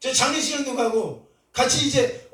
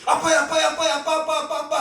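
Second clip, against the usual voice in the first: calling out; 6 semitones higher than usual. Retold or imitated. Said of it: "문 열고 들어가면서 멀리".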